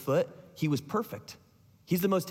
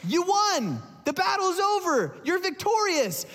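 high, excited proclamation; triumphant announcement; 12 semitones higher than usual. Retold or imitated. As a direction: cry out the victory news. Excited runner announces victory.

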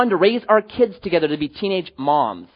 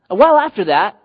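ordinary talk, nothing marked

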